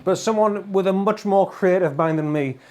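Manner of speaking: ordinary delivery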